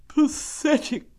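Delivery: high, drawn-out lament; slow, high donkey voice of self-pity; gloomy donkey. Acted. Donkey looking at himself in the water, gloomy.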